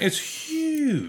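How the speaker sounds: high, awed exclamation. Real, not acted. Friend marvelling at the ocean, high and awed. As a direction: marvel at how huge it is.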